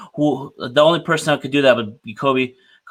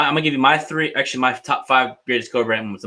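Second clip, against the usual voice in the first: ordinary delivery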